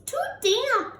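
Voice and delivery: high squeaky little mouse voice. Acted. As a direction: squeak it like a tiny fussy mouse. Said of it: "Mouse squeaks".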